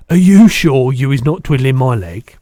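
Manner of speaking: loud giant's eager question; doubting BFG. A narrator's reading. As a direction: check loudly, hardly daring to believe.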